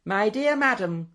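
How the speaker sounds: high, condescending professor voice; patronising. A narrator's reading. Professor answering the lady in the corner, patronising. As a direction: talk down to her from on high. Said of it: "replied Jaggles in a rather patronising tone".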